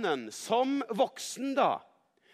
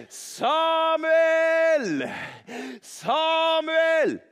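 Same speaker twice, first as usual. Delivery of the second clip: loud, high, long-held call; Gud calling out; 9 semitones higher, 8 dB louder, much slower than usual. A narrator's reading. Storyteller as the voice waking Samuel, loud drawn-out call. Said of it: "Gud som ropte på Samuel".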